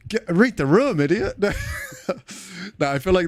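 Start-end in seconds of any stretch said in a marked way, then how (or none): 0.1-1.3 mock scolding.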